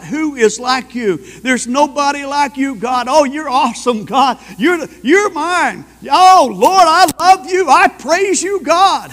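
rising to a loud, high cry of worship; ecstatic praise. Acted. Preacher voicing David bursting into praise, building to a loud high cry.

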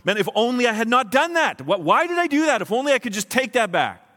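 high, agonized self-reproach; acted regret. Preacher voicing a remorseful person's thoughts, anguished regret. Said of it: "start to say to yourself".

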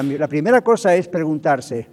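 normal talking voice

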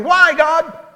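put-on high, whiny little-child cry of why; petulant toddler. Preacher voicing himself as a petulant toddler crying out. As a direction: plead like a two-year-old shaking a fist. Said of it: "picture myself as some petulant little two-year-old".

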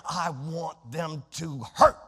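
slow, low, sinister voice of fleshly desire. Retold or imitated. Preacher voicing the flesh's craving, slow and low. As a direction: let the flesh speak darkly, slowly. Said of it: "fleshly desires that say".

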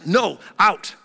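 a wife's sharp no to her husband; curt refusal. Retold or imitated. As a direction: shut him down flat.

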